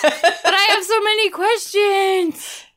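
high-pitched, drawn-out comic protest; mock exasperated wail. Viewer protesting to her husband about plot holes, exasperated wail. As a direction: wail it slowly through a laugh.

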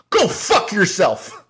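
high, slow, spat-out insult; slow, high furious parting shot; angry retort. Retold or imitated. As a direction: jab each word like a finger in his face. Angry man pointing in face.

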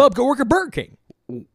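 high-pitched, jeering put-on voice; mock dismissal. Telling an unfit official to quit, mock dismissive.

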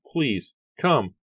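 high, loud and urgent; frightened plea. A narrator's reading. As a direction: beg her to come, shaken. Frightened girl pleads.

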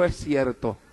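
mild imitated weeping; tearful admission. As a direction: say it like she's crying softly. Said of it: "ella lloró y me dijo".

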